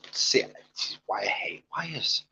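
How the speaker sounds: a fed-up hushed grumble at the cables; frustrated whisper. Real, not acted. Streamer tangled in cables, grumbling to himself in a whisper. Tangled in wires, muttering aside.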